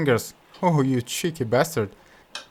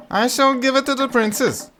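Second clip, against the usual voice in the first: excited Butthead; 11 semitones higher than usual. Acted. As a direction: show off your find. Butthead proudly replies.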